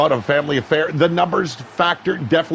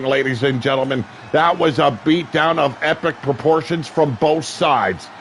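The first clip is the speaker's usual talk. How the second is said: usual speaking voice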